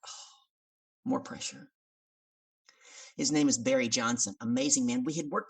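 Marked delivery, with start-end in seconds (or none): 0.1-1.7 soft, breath-only sigh of dread; hushed, acted dismay; dismayed inner thought. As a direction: say it to yourself under your breath.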